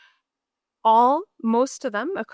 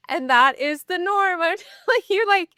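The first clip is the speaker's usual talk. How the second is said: laughing; 9 semitones higher, 5 dB louder than usual. Real, not acted. Laughing at the irony.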